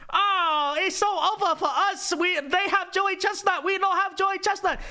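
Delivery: high-pitched mock lament in a put-on Chinese accent; Xi Jinping. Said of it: "Do you think Xi Jinping is quaking ... and is like".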